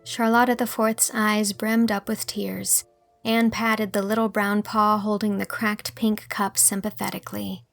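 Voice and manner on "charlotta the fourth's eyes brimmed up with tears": ordinary delivery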